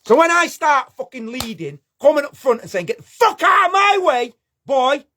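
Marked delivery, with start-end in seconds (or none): 2.9-5.1 a pretend shout, higher and pushy; mock commanding yell. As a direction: play the bully barking orders. Imagined leading, shoving people aside.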